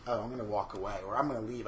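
low, soft aside imitating onlookers; mock dismissive. Imitating dismissive New York onlookers.

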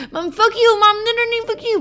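hammed-up, high angry protest; really mad. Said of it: "I started getting really mad".

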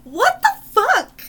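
high, drawn-out exclamation; real disbelief. Real, not acted. Appalled reaction to gross date story.

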